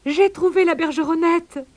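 high, breaking the good news; thrilled kangaroo with urgent news; excited cry. A narrator's reading. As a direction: announce it high and eagerly. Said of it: "cria-t-il".

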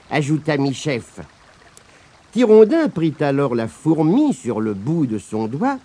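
ordinary delivery

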